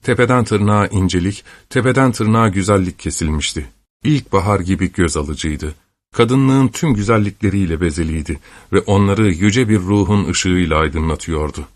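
ordinary delivery

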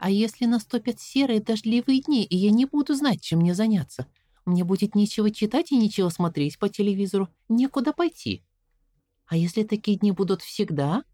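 put-on anxious little girl asking; girl's voice. A narrator's reading. Narrator voices young Caroline. (голос встревоженной девочки)

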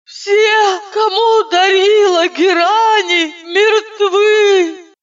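high, slow, wailing doom voice; hammed-up voice from the grave; sepulchral voice. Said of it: "загробным голосом сказала я".

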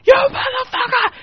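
very high, raw scream of rage; screaming like a madman. Retold or imitated. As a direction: scream it like a man who has lost it. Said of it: "he's screaming like a madman".

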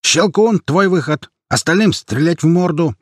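high, urgent commands; shouted battle orders. A narrator's reading. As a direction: shout orders over gunfire.

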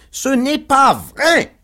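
high, loud, drawn-out protest yell; howled denial. A narrator's reading. Pickwick protests his innocence to the crowd, howling. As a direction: howl each word to convince any bystander. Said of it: "hurla monsieur Pickwick sur un ton propre".

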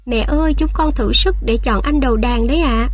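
usual speaking voice

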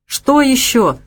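a touch of exasperated impatience; impatient. A narrator's reading. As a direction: ask it with mild impatience. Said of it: "нетерпеливо спросил Никита".

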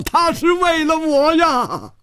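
very high, anguished screaming; strained wail, pitch soaring; torn-voiced cry. A narrator's reading. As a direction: tear the voice crying out in desperation. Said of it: "忽然撕着声音喊道".

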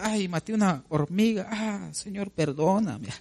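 playful imitation of a weepy believer; mock crybaby. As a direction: ham up an over-dramatic sinner begging forgiveness. Mocking someone who cries over nothing.

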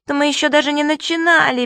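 little creature squeaking in protest. A narrator's reading. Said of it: "пискнул Субастик".

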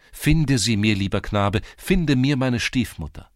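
loud, ringing, desperate pleading. A narrator's reading. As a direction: cry out the plea at full voice. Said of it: "Ihre Stimme, die immer tönender geworden war". (laut und verzweifelt flehend)

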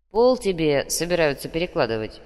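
ordinary delivery

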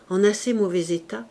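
ordinary delivery, nothing marked

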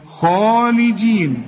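خالدين very slow, full dark خ and long alif; heavy first letter. Shown as a demonstration. Teacher showing the letter after the rule, heavy خالدين. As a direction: thicken and stretch the خا of خالدين. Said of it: "ما دام عليها فتحة مفخمة".

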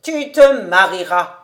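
loud, nasal repeat of a prophecy; ironic nasal taunt. A narrator's reading. Old rabbi insists Kobus will marry, ironic nasal taunt. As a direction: drive it home through the nose, ironically. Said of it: "en nasillant d'un air ironique".

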